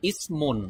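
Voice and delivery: ইসমুন said carefully as the correct Arabic form; correct Arabic pronunciation. Shown as a demonstration. Teacher gives learners the correct Arabic word, careful pronunciation. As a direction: model ইসমুন clearly for learners. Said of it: "সঠিক উচ্চারণ হবে".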